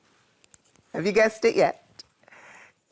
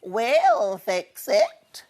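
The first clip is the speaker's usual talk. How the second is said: slow, different soothing sheep voice; 4 semitones higher, 4 dB louder, much slower than usual. A narrator's reading. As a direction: promise help gently as the sheep. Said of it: "said the sheep".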